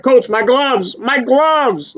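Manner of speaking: high, frantic cry about the gloves; panicked plea to the coach; mock panic. Boxer panicking to his coach about gloves, high frantic cry. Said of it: "having a borderline panic attack".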